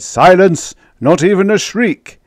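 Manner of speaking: sharp loud high shout for silence; bellman's cry. Acted. Bellman silencing the crew, loud high cry. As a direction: bark it out to hush the crowd. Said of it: "the bellman cried".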